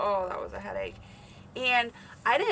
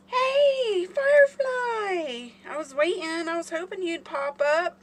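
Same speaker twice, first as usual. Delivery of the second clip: high, loud, drawn-out happy hail; delighted squeal easing into high, eager chat; excited greeting; 9 semitones higher, 8 dB louder, slower than usual. Real, not acted. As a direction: greet a long-awaited friend joining in. Greets awaited friend joining live.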